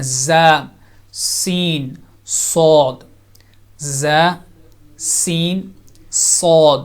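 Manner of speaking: each letter said slow and separate; letters said clearly for the class; teacher demonstrates letters. Shown as a demonstration. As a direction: sound out ز, سین, صاد one by one. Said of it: "تین مزید حروف".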